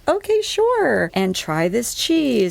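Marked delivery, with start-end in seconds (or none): 0.1-1.1 high and drawn out. Retold or imitated. Her own quoted reply.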